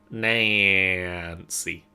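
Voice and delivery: drawled Texan "Nancy"; Texan accent. Pretend. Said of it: "Nancy in a Texan accent".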